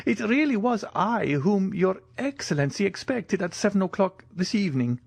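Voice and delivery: put-on high-pitched old soldier, eager and a little worried; anxious Italian major. Italian visitor fears his arrival went unannounced, high anxious voice.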